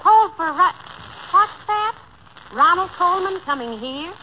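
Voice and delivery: amazed, questioning repeat; incredulous. Acted. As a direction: echo it in disbelief. Amazed at telegram's news.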